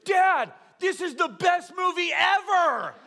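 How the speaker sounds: loud, high mock shout of an excited kid; excited child. Small daughter to dad during the trailers, excited shout. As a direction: blurt it out like a thrilled little daughter. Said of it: "she leans over and she goes".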